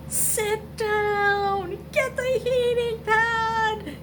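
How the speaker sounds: high, loud, slow demanding voice; screaming back. Acted. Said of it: "my back is constantly screaming".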